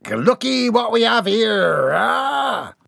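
high, slow gloating pirate drawl. Acted. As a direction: savour the catch like a greedy pirate. Pirate gloats over captive.

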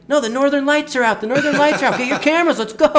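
very high, loud, laughing excitement; thrilled shout. Retold or imitated. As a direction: shout it out, overjoyed about the lights. Guide thrilled about Northern Lights.

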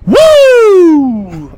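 high, loud Flair-style woo yell; Ric Flair woo. Retold or imitated. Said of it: "way cooler than Ric Flair".

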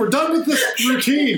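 exasperated shout. Retold or imitated. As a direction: shout it like you're quitting for good. Retold exasperated outburst.

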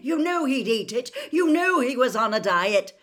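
furious father at full volume; angry roar. A narrator's reading. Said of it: "roared Mister Weasley".

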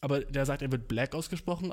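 ordinary delivery

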